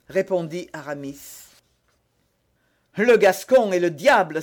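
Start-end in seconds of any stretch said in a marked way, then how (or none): 3.0-4.4 loud, emphatic cry of amazement; exclamation. A narrator's reading. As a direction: exclaim it loudly. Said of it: "s'écria Athos". (exclamation sonore)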